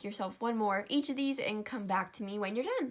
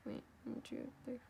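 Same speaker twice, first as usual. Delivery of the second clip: counting in a whisper; 3 semitones higher, 12 dB quieter than usual. Real, not acted. Counting stitches under breath.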